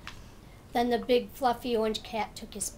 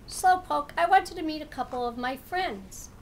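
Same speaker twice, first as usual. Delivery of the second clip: louder, higher cat greeting; excited cat; 5 semitones higher, 5 dB louder than usual. Acted. Cat greets the turtle at the pond, excited. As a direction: call out to the turtle happily.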